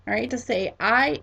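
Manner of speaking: ordinary talk, nothing marked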